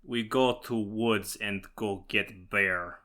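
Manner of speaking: put-on slow, broken-English parody Russian accent; mock Russian accent. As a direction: lay on a joke Russian accent, slowly. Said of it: "uses broken English".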